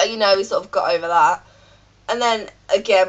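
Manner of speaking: normal talking voice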